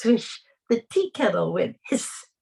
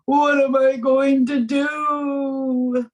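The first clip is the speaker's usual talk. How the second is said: louder, higher, slow anguished wail; desperate cry; 6 semitones higher, 6 dB louder, slower than usual. Acted. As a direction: cry out in despair. Isaac despairs, cannot sleep.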